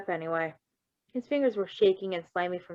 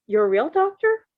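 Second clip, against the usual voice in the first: surprised question; 9 semitones higher, 8 dB louder than usual. Acted. Virgil surprised by the news.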